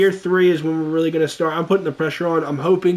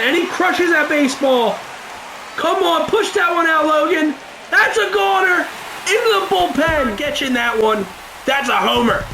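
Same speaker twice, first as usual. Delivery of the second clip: excited home run call; 9 semitones higher than usual. Real, not acted. Calling a home run live.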